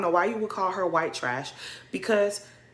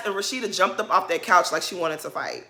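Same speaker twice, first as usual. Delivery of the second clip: normal talking voice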